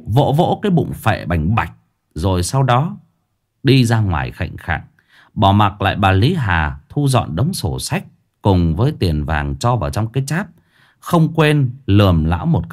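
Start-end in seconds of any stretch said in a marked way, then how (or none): none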